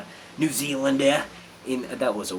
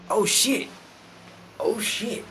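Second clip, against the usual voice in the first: pleasantly surprised; 10 semitones higher, much slower than usual. Real, not acted. Regular viewer appears.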